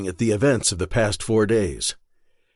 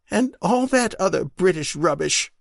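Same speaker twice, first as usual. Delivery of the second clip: higher wife's voice, wry and teasing after tears; Marion, teasing wife; 8 semitones higher than usual. Acted. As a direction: tease him gently through relief.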